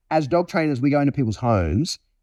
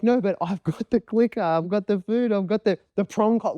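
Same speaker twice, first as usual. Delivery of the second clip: higher-pitched mock owner protest; 6 semitones higher than usual. Owner objecting to the dog with clicker and food, higher mock protest. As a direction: list the tools like a pleading handler. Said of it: "And now we're like".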